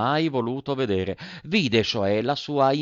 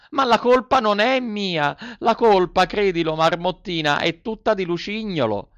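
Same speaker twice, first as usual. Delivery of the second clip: much higher and louder, pleading puppet; Pinocchio, desperate excuse; 10 semitones higher, 5 dB louder than usual. A narrator's reading. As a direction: blame the friend in a rush of panic. Pinocchio voiced high. (il burattino si discolpa, acuto e disperato)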